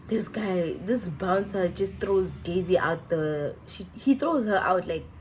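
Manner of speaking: usual speaking voice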